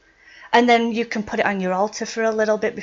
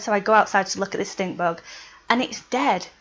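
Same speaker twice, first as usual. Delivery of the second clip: ordinary talk, nothing marked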